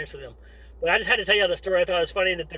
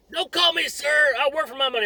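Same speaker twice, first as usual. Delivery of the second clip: drill sergeant yelling; 8 semitones higher than usual. Retold or imitated. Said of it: "they're just yelling at me".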